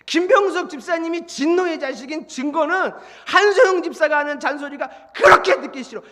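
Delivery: ordinary delivery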